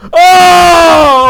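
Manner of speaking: long, high, loud scream; terrified scream. Real, not acted. Player caught off guard by Granny, terrified scream. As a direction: scream out at a jump scare. Jump scare in horror game.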